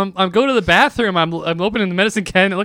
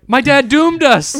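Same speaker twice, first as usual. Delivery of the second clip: mock doom; 8 semitones higher, 6 dB louder, slower than usual. Doomed by dad, mock dramatic.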